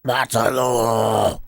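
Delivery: strained, drawn-out rasping yell; harsh shout. Shown as a demonstration. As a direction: push the throat hard, slowly. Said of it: "If I do it too hard, then it hurts".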